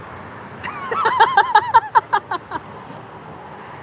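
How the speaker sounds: a long, very high squeal of a laugh. Real, not acted. Streamer sees the pet below him, long squealing laugh.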